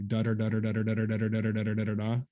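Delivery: a long even run of "da" syllables, steady and quick like double tonguing. Shown as a demonstration. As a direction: chatter "da da da" evenly in tonguing rhythm. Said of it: "I think I say, like".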